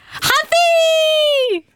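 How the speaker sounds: high, slow, belted "ハッピー" from the gut; from the belly. Shown as a demonstration. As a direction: cheer "ハッピー" with your whole body. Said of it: "お腹の中から声を出しました".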